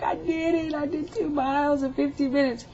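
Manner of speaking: high-pitched mock bawling with joy; tearful excitement. Mother telling her son about two miles, tearfully elated. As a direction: imitate wailing out proud news through happy tears. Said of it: "I was crying".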